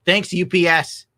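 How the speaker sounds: loud, slow sarcastic thanks. Real, not acted. Streamer blaming the shipper for the broken statue, sarcastic and loud. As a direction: thank them, dripping sarcasm. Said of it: "sarcastic thanks to shipper".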